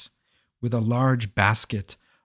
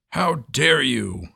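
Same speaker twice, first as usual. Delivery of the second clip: roar; 3 semitones higher, slower than usual. A narrator's reading. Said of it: "roared the king".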